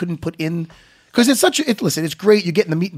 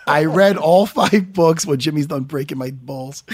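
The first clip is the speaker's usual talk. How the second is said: ordinary delivery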